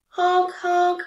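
loud, high goose honk, a touch different. A narrator's reading. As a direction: honk again like the goose outside. Goose honk imitated again.

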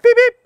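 squeaky high electronic beep; high beep noise. Shown as a demonstration. Firefighter showing kids the alarm beep. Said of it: "now go beep".

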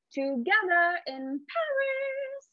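high, slow, grand. Shown as a demonstration. Teacher as the grandmother, high and slow. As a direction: lift it up high like tossing a crown. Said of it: "go up really high with your voice".

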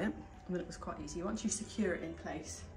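ordinary delivery

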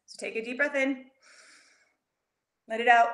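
calm breathing demo with an audible sigh; guided breathing. Shown as a demonstration. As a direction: breathe in, then let it out with a sigh. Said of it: "This always helps me".

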